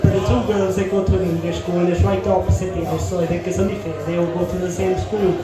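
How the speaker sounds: usual speaking voice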